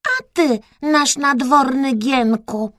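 high-pitched royal address; play-acting princess. A narrator's reading. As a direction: address your court servant from a throne. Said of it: "zwróciła się Kreska do gołębia".

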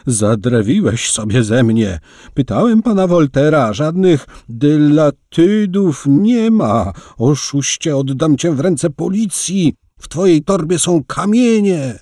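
the innkeeper rants at Latit about the stones, yelling angrily; angry yelling. A narrator's reading. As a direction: scream accusations at the swindler. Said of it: "wrzasnął stary".

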